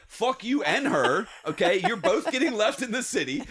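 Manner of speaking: mock outraged dismissal; retold angry defiance. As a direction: tell them both off, acted out. Retold quarrel with designated driver.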